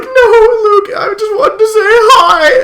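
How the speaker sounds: very high, loud crying whine; mock wailing. Streamer replays pleading with angry Luke, fake-crying wail. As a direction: wail it slowly like a hurt child. Acts out being scared by Luke.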